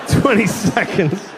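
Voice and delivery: "twenty seconds" drawn out slowly; stretched twenty seconds. Real, not acted. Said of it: "That's a long time".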